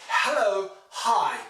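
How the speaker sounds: loud 'Hello, high' with strong H on both; 'Hello, high', loudly modelled with audible H's; sounded H. Shown as a demonstration. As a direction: punch the breathy H in 'Hello' and 'high'. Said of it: "You say".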